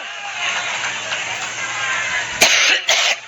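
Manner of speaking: long hacking coughing fit; racking cough, struggling to stop; chesty cough. Real, not acted. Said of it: "once I get over my allergic reaction".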